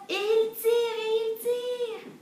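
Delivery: high, slow singsong heave; straining pull refrain. A narrator's reading. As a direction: chant the pulling, long and loud. Story's repeated pulling refrain.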